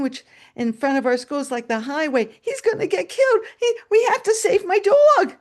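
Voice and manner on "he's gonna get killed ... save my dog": high, loud frantic plea; panicked pleading boy. Retold or imitated. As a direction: imitate a child begging urgently for help. Boy pleads for dog's life.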